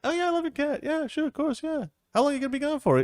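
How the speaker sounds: very high, eager friend voice; high-pitched cheerful friend agreeing to everything; Jeffrey voice. Acted. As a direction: squeak it out like an overeager buddy.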